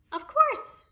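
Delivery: Cherry Sue voice. Acted. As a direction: chirp it up high as the kind neighbor.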